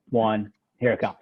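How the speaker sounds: ordinary delivery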